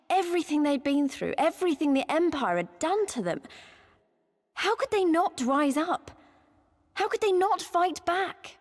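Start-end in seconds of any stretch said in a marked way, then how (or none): 4.5-6.1 higher, slow, indignant question; frustrated anger. Real, not acted. To a friend about the Domans, slow indignant anger. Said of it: "the thought of it made me so angry". 6.9-8.6 much higher, slow, pained demand; rising frustrated anger. Real, not acted. To a friend about the Domans, climbing higher indignant anger. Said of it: "the thought of it made me so angry".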